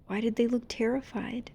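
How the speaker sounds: plain reading voice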